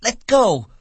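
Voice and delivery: very high, loud woman's cry. A narrator's reading. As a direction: cry out in alarm. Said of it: "she cried".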